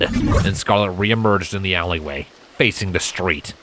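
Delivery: ordinary delivery, nothing marked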